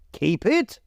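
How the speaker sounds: high, rising disbelief; incredulous repeat. A narrator's reading. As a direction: echo his word in amazement. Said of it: "repeated Scrooge's nephew".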